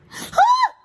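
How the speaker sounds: high loud yelp; a sharp startled yelp; dog yelp. Retold or imitated. Owner imitating her dog's piercing yelp, high and loud. Dog yelps at paper cut.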